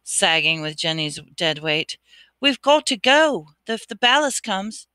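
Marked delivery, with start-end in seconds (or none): 2.4-4.9 higher, louder, pressing; urgent. Acted. Harry urging Riddle to flee the Basilisk, urgent. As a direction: push to leave now. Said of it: "said Harry urgently".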